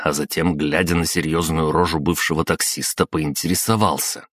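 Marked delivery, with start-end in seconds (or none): none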